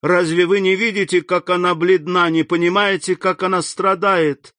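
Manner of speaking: high, urgent pleading; high-pitched urgent plea. A narrator's reading. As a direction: beg him to see her pain. Valentina pleading for Haydée.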